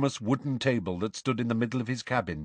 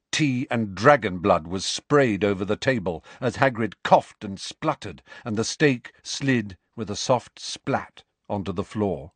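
ordinary delivery

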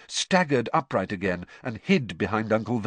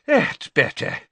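panting; 5 semitones higher, 5 dB louder than usual. Acted. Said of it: "panted Mister Weasley".

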